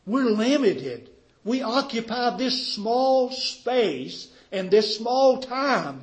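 slow, loud, high-pitched preacher's voice; forceful preaching. Real, not acted. Preacher telling the congregation of human limits, forceful preaching. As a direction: drive home each measured phrase. Preacher contrasting man with God.

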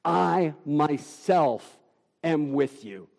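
slow, solemn stress on "myself"; emphatic myself. Shown as a demonstration. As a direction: linger on "myself", then let it drop. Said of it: "the Greek here is emphatic".

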